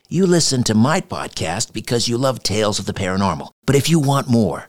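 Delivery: upbeat ad read; podcast ad read. Acted. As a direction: sell the show, friendly. Reads a podcast ad.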